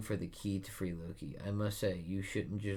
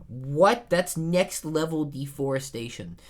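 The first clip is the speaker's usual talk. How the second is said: much louder and higher; shocked; 8 semitones higher, 10 dB louder than usual. Real, not acted. Viewer shocked by the stripped mountain, louder and higher. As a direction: gasp it out in disbelief. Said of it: "shocked reaction".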